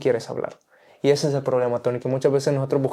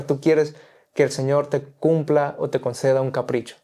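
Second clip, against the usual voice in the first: ordinary delivery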